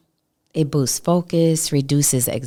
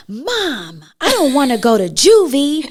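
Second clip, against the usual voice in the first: put-on higher, louder worried kid voice; imitating her son; 8 semitones higher, 6 dB louder than usual. Mother quoting her son's fearful answer, mock kid voice. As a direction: blurt it out like a scared little boy. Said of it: "And he said".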